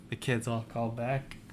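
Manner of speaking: ordinary delivery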